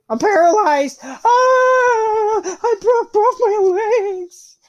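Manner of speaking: high, loud, very slow hammed-up wail; mock pain yell. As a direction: overact the broken legs. Pretend injury after a fall.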